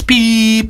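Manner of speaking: long high steady tone; one sustained high beep; imitated answerphone beep. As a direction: mimic the machine's end-of-message beep. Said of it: "was beeping away on the answer machine".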